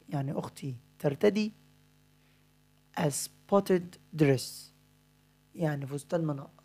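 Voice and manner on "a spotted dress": dictating. Shown as a demonstration. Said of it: "أنا دلوقتي همليك بعض الكلمات".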